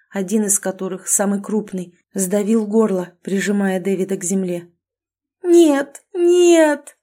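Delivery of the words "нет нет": high, loud screamed refusal; furious scream. Acted. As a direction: scream it in horror. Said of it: "яростно заорала". (яростный крик)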